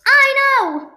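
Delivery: high, loud "I know!"; Monica's excited I know. Retold or imitated. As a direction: burst it out like Monica. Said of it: "Monica says that nearly in every episode".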